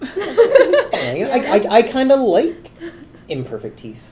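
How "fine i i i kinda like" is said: high laughing concession. Real, not acted. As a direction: giggle through the words. Laughing concession about teeth.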